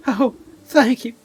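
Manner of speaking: very high, loud Southern maiden; tearful Southern belle, sweetly overdone; Southern accent. Acted. Maiden thanking old Granny, high, loud Southern accent. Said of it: "giving her a southern accent".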